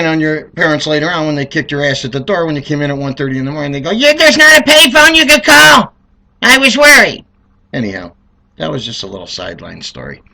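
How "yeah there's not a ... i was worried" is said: mocking parent. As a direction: sneer it back in a high mocking pitch. Parents mocking late kid.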